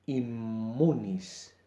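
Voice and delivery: immunis drawn out slowly with a long double M. Shown as a demonstration. Teacher dictating a Latin word, slow and careful. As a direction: stretch the MM in immunis. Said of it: "La doble M".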